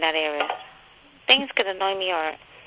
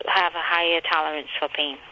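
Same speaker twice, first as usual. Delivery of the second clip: ordinary delivery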